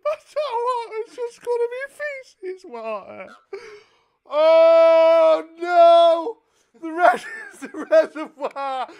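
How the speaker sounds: high, wailing, hammed-up sob; fake-weep it like a dramatic breakdown; mock crying. Prank caller fake-sobbing down the phone to the water company. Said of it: "caught up in my emotions".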